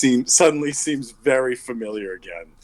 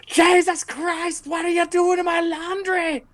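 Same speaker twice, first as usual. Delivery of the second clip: screaming laundry woman. Acted. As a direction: screech in outrage over ruined laundry. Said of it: "you hear this voice scream".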